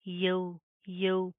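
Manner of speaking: यौ gliding from "ya" into "w"; two-part "yau" vowel in यौ; ya-waw diphthong. Shown as a demonstration. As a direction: open यौ on a, then round into w. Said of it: "या वाव ज़बर".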